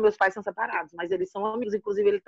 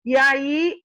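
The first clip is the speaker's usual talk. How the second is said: normal talking voice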